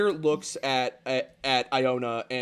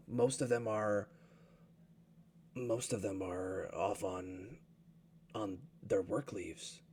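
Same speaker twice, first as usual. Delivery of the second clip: low, quiet, slowed-down elder speaking heavily; an old leader admitting they have no one to spare; grim low elder; 7 semitones lower, 10 dB quieter than usual. Acted. Glader telling Thaddeus no warriors remain, low and heavy.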